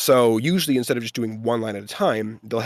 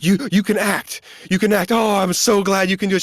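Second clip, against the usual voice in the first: relieved praise; 9 semitones higher, 4 dB louder than usual. Retold or imitated. Relieved director praising him.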